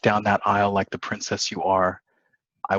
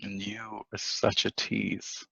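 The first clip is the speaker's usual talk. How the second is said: slow and much softer, intimate; flirty murmur; 7 dB quieter, slower than usual. Acted. James flirting back with Alicia, slow soft murmur. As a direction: murmur it close to her.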